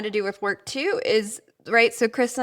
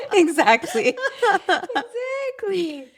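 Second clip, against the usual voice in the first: high, drawn-out words through real laughter; helpless laugh stretching the word; laughing agreement; 13 semitones higher, slower than usual. Real, not acted. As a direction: agree while cracking up. Cracking up at co-host's joke.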